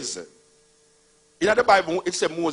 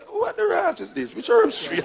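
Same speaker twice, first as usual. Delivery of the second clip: incredulous squeal; 4 dB louder than usual. Retold or imitated. Mock reply to Rasta claim.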